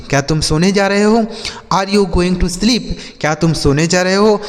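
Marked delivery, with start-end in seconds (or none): 1.7-2.9 slow, careful English question; slow model reading. Shown as a demonstration. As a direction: read it out slowly for learners. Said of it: "slow model sentence repeated".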